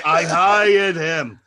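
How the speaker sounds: drawn-out, loud declaration; imitated stretched-out shout; loud announcement. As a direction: holler it slowly over the squabble. Retold woman shouting her answer.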